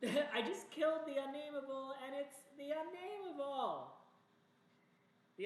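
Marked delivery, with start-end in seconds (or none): 0.0-3.9 high-pitched incredulous complaint; exasperated disbelief. Real, not acted. Player sees the killed monster return, exasperated disbelief. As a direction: protest in a much higher voice, laughing as you start.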